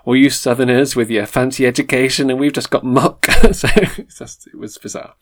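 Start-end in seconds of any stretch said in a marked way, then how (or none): 0.1-3.2 hammed-up Northern English grumble; badly faked Northern English accent, aggrieved; attempted Northern English accent. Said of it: "I can't do a Northern accent".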